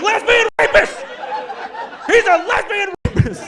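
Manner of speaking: high, loud mob yell; imitating hecklers yelling in delight; crowd shouting. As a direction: shout him down like an angry mob.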